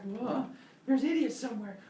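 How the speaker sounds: high startled cry of discovery; startled alarm. Acted. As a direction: sorpresa aguda de Prometeo. Prometheus senses idiots.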